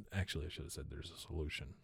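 quiet aside. Real, not acted. Self-correction muttered aside.

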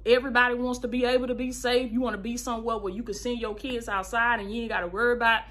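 ordinary talk

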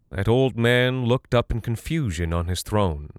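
ordinary delivery, nothing marked

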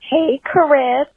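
cracking voice on the name. Real, not acted. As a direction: voice cracks on the greeting. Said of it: "Oh my God, my voice just cracked".

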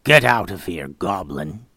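biting down on pain, words pushed through teeth; through gritted teeth. Acted. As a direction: spit it through clenched teeth. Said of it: "through gritted teeth said".

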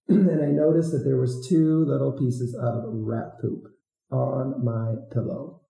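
ordinary talk, nothing marked

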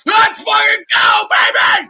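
loud, slow hype yell; hype shout. Real, not acted. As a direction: roar it out like a fan. Guest hypes up on stream.

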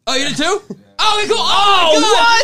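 very high, loud outburst of relief; delighted disbelief. Real, not acted. Learning cohost left early too, elated shouting. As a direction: shriek with surprise and relief.

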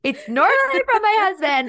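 laughing high and loud through the words; real laugh breaking into the line; bursting out laughing mid-sentence. Real, not acted. Laughing at friend's flirty joke.

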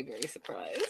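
very soft, whispered emphasis; hushed whisper. Real, not acted. Host telling viewers of her surprise, in a whisper. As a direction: drop right down to a whisper. Dramatic understatement of her shock.

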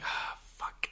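mock worker cursing under the breath; whispered curse. Imitating the annoyed sandwich worker, whispered.

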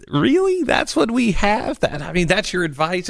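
can't believe that's the advice; incredulous. Real, not acted. Scoffing at the FBI's advice.

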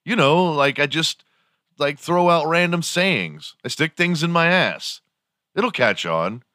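high goofy explaining voice. Pretend. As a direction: explain it like a goofball. [name] in skit.

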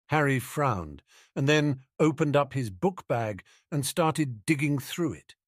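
plain reading voice

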